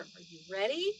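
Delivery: usual speaking voice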